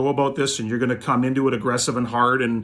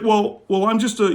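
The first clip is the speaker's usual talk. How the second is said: higher, louder mock of a backpedaling man; mock whiny collector; 8 semitones higher, 4 dB louder than usual. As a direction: imitate him caving in, pitched up. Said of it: "it fucking flipped".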